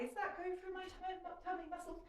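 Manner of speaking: voiced inner question. Instructor voicing what the exerciser should ask herself, high questioning. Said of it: "And just think about".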